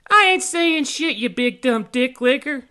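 very high, loud, slow fairy jeer; defiant fairy. A narrator's reading. Tink refusing the pirate, high defiant yell.

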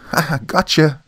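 high, loud triumphant yell; a gleeful shout from the field; screaming. A narrator's reading. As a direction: burst out shouting gotcha. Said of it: "Tony burst from the field screaming".

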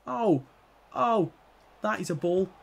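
high, loud amazed cries; excited shout. Real, not acted. Gamer yelling at a great pass, loud and amazed. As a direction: yell in delight at a great pass.

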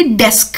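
डेस्क with a crisp, clearly sounded final k; stress the k sound. Shown as a demonstration. Teacher drills the k sound at the end of डेस्क. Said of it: "क sound, इस पर थोड़ी सी practice".

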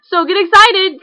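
rally them, gleeful; promising a party, buzzing; excited hype. Real, not acted. Hyping up coming home.

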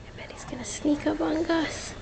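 hushed whisper aside. Real, not acted. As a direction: whisper so the cat won't hear. Hushed aside while cats stalk.

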